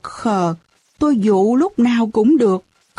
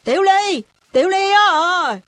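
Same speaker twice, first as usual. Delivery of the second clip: calling across; 8 semitones higher than usual. A narrator's reading. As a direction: call a playmate over from next door. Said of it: "gọi vọng sang".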